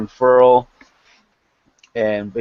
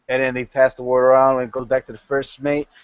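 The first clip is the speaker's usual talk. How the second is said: ordinary delivery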